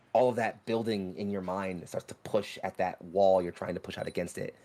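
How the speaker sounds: ordinary talk, nothing marked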